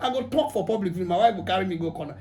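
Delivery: usual speaking voice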